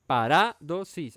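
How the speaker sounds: "Parádosis" slowly broken up as pa-RÁ, do, sis; syllables counted out. Shown as a demonstration. Preacher counting syllables to place the accent, slowly split. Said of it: "esa es en la tercera".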